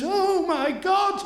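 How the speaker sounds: high, slow, loud oh my God; shocked cry. Retold or imitated. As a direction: stretch it out in horror. Director reacts to accident.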